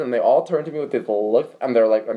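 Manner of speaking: ordinary talk, nothing marked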